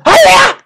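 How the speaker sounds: very high, loud martial-arts cry; karate yell. Pretend. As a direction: yell it like a movie kung fu strike. Karate shout after mock threat.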